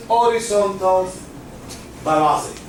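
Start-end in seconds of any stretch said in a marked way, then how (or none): none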